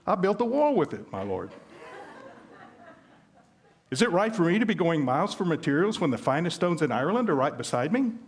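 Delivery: agent explaining. Acted. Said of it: "said the agent".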